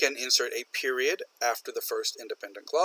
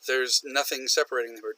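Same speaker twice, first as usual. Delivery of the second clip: ordinary talk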